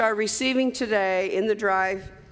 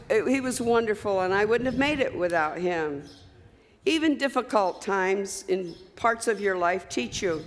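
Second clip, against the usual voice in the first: ordinary delivery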